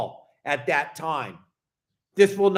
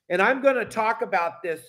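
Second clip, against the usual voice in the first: ordinary delivery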